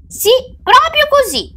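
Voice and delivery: put-on very high child voice; sly child. A narrator's reading. Child outwitting the witch, high-pitched sly confirmation. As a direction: pipe it up like a clever kid. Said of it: "continuò argutamente Pietro".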